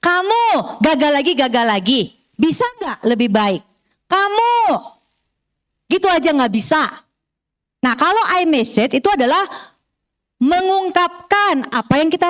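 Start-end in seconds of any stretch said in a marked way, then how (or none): 0.0-7.0 mildly mock scolding of a child, stress on Kamu; a hint of a nagging mother; scolding parent. Shown as a demonstration. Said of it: "You message itu seringkali kita dengar".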